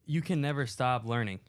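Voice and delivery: ordinary talk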